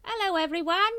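a silly squeaky greeting; squeaky mock king. A narrator's reading. Watchtower mocking the prophesied king, squeaky. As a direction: squeak it high and loud. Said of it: "he squeaked".

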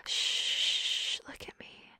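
soft breathy whisper. Acted. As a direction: drop to a bare whisper. Voiced command, then whispered.

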